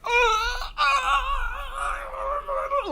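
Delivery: high mock sobbing wail; hammed-up teary wail; wailing cry. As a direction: bawl it out, overcome with joy. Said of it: "she's like".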